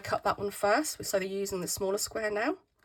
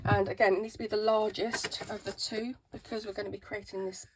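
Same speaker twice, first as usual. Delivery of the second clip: squeaky voice. Real, not acted. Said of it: "Sorry about my squeaky voice today".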